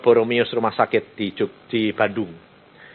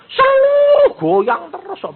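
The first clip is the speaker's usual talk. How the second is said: much higher, slow and drawn out; insistent daughter; 5 dB louder, slower than usual. Acted. Daughter insists to the pastor her mother won't stop shaking, high and slow. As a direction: stretch it high like a distressed daughter.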